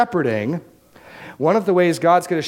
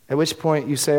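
ordinary delivery

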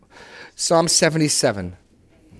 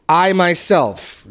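"I, I myself" slow, every word leaned on; slow down and punch "I" and "myself"; emphasis on I myself; 4 semitones higher, 3 dB louder, much slower than usual. Shown as a demonstration. Teacher points the class to God's emphatic "I, I myself", stressed slowly. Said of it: "don't miss the emphasis in".

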